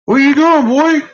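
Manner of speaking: high, loud call to the dog. Real, not acted. Owner calling to the dog, high and loud. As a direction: sing out at the dog, startled.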